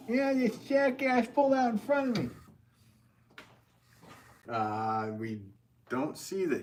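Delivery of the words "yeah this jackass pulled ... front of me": much higher, brash New Jersey driver voice; a fed-up New Jersey guy griping in a thick Jersey accent. Acted. Angry driver phoning his insurer, in a loud Jersey accent. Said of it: "I went with the Jersey accent there".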